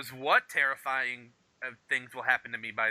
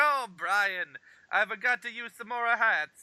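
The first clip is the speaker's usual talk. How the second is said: a light mock Italian accent for the hat guy; 7 semitones higher, slower than usual. Cohost imitating Brian's hat guy in a light Italian accent. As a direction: play the friendly Italian haberdasher, only lightly accented. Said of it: "I don't know why he's Italian".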